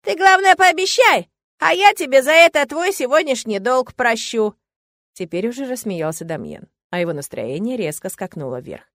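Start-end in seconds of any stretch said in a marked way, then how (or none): 0.1-4.5 ingratiating. Acted. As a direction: sweet-talk him into the promise. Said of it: "вкрадчиво добавил".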